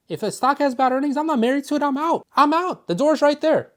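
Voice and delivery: high, loud, emphatic; heated insistence. Real, not acted. Coach ranting to viewers about dumping bad stocks, high and loud. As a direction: hammer home that you just walk away.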